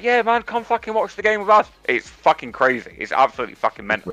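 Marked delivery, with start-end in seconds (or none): 0.0-1.7 high, eager welcome; welcoming tailgater. Retold or imitated. Tailgater invites ticketless fans in, high and eager. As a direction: pitch up like a friendly American fan.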